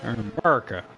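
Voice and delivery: low Texan "America", swallowed to 'Murca; Texas accent. Retold or imitated. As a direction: put on a Texas twang for "America". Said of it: "That's how they say it in Texas".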